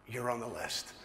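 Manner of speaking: soft, low delivery of big news; hushed, weighty reveal; understated reveal. Retold or imitated.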